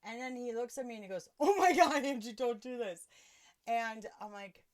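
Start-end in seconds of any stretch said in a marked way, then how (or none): 1.4-3.1 high, loud opening outburst, mock alarm; alarmed pleading. Friend begs the buzzed woman not to do this, mock-alarmed. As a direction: imitate a friend freaking out, voice jumping up. Jordan's alarmed reaction, acted.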